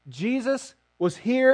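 ordinary talk

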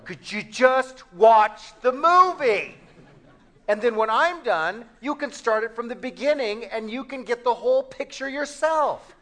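loud, high-pitched, acted exasperation at being interrupted; fed-up spouse shouting over a movie; exasperated outburst. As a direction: blow up at the pestering questioner, stretching out the first words.

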